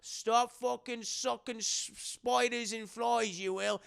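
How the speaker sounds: slow Cockney orderly telling him off; Cockney scolding. Retold or imitated. The orderly scolds Renfield in Cockney.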